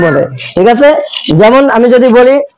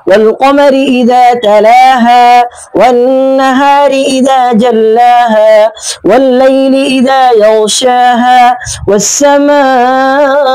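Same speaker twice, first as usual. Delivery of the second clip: slow melodic tajweed chanting; measured Quran recitation with drawn-out verse ends; Quranic recitation; much slower than usual. Shown as a demonstration. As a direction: chant each verse slowly, stretching the endings. Recites Surah Shams.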